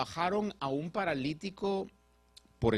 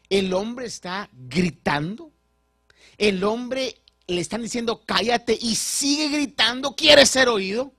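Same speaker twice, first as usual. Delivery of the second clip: louder, rising preaching voice; fervent preaching; 7 semitones higher, 9 dB louder than usual. Acted. Preacher describing the blind man shouting, louder and higher. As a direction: press the point with growing force.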